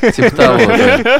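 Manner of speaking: high laughter spilling into the words; laughing. Real, not acted. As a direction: laugh through the reply. Laughs at the joke.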